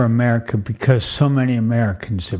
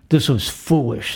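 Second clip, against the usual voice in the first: normal talking voice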